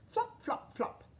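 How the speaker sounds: high playful flops; flopping sound. A narrator's reading. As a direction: slap out each flop. Jumping off the bus.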